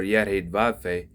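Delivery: "Yahweh" said as the letters yod-he-vav-he; Hebrew letter-name pronunciation. Shown as a demonstration. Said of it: "different pronunciations of the holy name".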